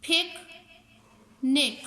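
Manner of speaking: पिकनिक as two separate beats, पिक, then निक; teacher sounding out पिक—निक; split into syllables. Shown as a demonstration. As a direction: say पिकनिक slowly, pausing after पिक. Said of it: "टू vowel sound देते हों".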